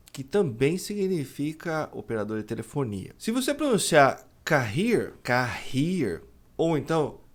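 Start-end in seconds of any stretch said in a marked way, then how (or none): 4.4-6.2 "carrier" as ka-HEER; H-like Brazilian R, stress on last syllable of "carrier"; mispronounced double R. Shown as a demonstration. Teacher imitates learners' error, Portuguese-style R. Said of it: "pronunciar com os dois R's".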